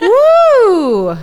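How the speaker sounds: loud, high whoop; excited cheer. Real, not acted. Cheering the show opening.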